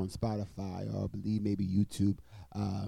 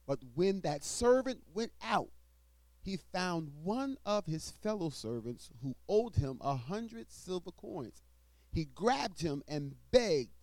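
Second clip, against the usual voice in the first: scripture reading; 6 semitones higher than usual. Acted. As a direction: proclaim the verses to the congregation. Reads scripture aloud in sermon.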